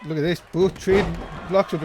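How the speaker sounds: usual speaking voice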